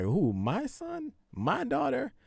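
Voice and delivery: high, incredulous parent voice; acting the baffled parent, voice shooting up; mock disbelief. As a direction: squeak it out in feigned shock.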